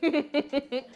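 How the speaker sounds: quick bubbling chuckle; joking laugh. Real, not acted. Teacher joking about ashy kneecaps, amused chuckle. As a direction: laugh at your own joke.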